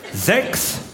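usual speaking voice

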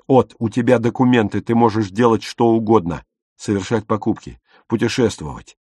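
ordinary delivery

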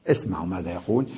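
ordinary delivery

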